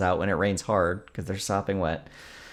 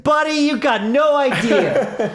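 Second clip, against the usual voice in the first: high, loud, slowing exasperated plea to a clerk; exasperated rant; 9 semitones higher, 5 dB louder than usual. Retold or imitated. To the store clerk, a high, loud exasperated rant. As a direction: pour out the frustration, pitched way up. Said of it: "And I was like".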